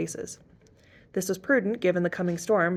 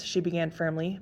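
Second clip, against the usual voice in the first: usual speaking voice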